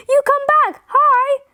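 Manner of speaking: high-pitched storybook yell. A narrator's reading. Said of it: "he yelled".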